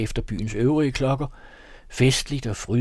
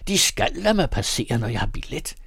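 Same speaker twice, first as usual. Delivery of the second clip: usual speaking voice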